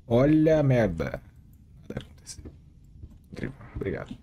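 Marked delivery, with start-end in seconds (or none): 1.9-4.2 breathy whisper of disappointment; whispered aside. Real, not acted. Gamer whispering to himself as nothing happens. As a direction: whisper it under your breath. Quiet aside while game plays.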